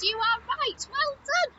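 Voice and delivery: high-pitched, delighted congratulation; excited praise. Pretend. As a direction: cheer the child's right answer. Praising children for right answer.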